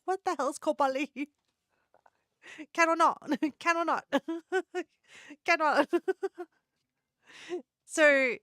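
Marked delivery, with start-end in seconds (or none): 2.7-5.9 mock Singaporean Can or not, said higher; clipped Singaporean English with laughter; Singaporean accent. Vlogger jokes about Singaporean speech in a mock Singaporean accent. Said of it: "the joke is that Singaporeans".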